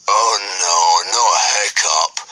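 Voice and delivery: put-on a slow, mild groan from a dim boy; boy groaning at the news. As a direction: drag out the complaint. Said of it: "groaned Dog's Breath the Durbrain".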